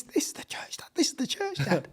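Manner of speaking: urgent stage whisper; imitated child's whisper. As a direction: whisper it like an insistent little boy. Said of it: "my little boy's going like this".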